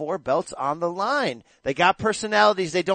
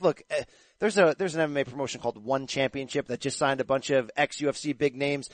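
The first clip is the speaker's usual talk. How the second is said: usual speaking voice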